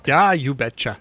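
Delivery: put-on Midwestern accent, drawn out. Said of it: "don't forget your Midwestern accent".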